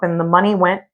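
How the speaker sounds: ordinary delivery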